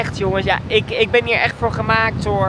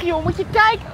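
usual speaking voice

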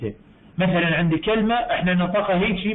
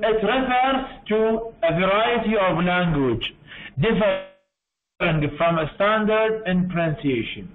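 very slow, word-by-word reading of the English definition; slow dictation; much slower than usual. Shown as a demonstration. Teacher dictating a definition to the class, very slow. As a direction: read it out slowly so students can follow. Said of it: "teacher reads definition slowly".

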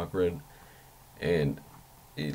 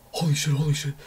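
higher, startled mock panic; a quick alarmed gasp of words; 8 semitones higher, 3 dB louder than usual. Spots motion, starts freaking out.

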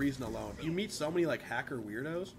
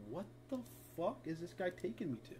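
fast, much softer bewildered mutter; confused mutter; 2 semitones higher, 9 dB quieter than usual. Real, not acted. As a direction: mumble it half to yourself.

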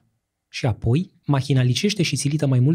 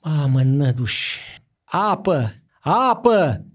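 rising cries for water; gasping plea, rising to a cry; desperate gasping plea; 2 semitones higher, slower than usual. A narrator's reading. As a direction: beg for water, choking. Ruler gasps for water.

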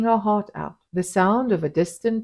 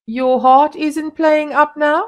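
not unkindly; 9 semitones higher, 8 dB louder than usual. A narrator's reading. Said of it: "asked Mustapa, not unkindly".

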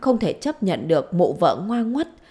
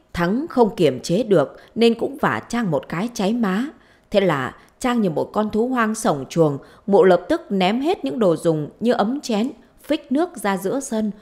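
ordinary delivery, nothing marked

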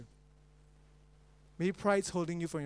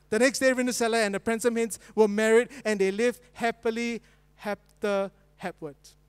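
lively, louder storytelling of a tangled fairy tale; comic story narration; 4 semitones higher, 8 dB louder than usual. Acted. Preacher telling a joke story to the congregation, lively storyteller. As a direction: tell the mixed-up Cinderella tale with relish.

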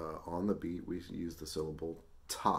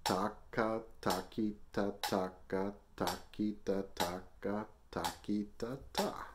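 rhythmic switching between pairs and triplets; alternating twos and threes; 2 semitones higher, slower than usual. Shown as a demonstration. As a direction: chant strictly on the beat, alternating two and three. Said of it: "or go back and forth".

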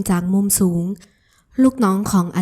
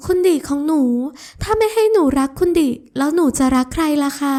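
put-on teasing sweet girl; 6 semitones higher than usual. Ramon teases Adisa for acting cute, high and playful. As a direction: tease her fondly, voice lifted.

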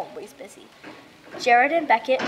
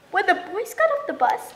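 ordinary delivery, nothing marked

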